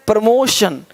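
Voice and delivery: ordinary talk, nothing marked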